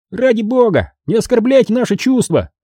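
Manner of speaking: high-pitched sharp rebuke; sharp little gnome voice. A narrator's reading. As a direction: say it high and offended. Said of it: "резко сказал Фикс".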